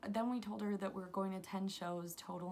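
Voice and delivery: ordinary talk, nothing marked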